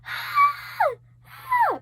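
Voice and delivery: high-pitched retold scream, not full force; mock scream. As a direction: scream it out high like waking from a nightmare. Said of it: "I just start screaming".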